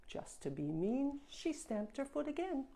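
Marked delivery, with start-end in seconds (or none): none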